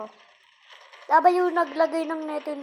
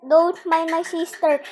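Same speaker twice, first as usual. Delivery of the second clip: ordinary delivery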